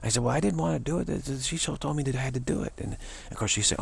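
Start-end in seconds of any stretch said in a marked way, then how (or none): 0.2-2.7 higher-pitched, defensive excuse; mock protesting excuse. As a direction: plead that it wasn't your fault. Defending himself in acted excuse.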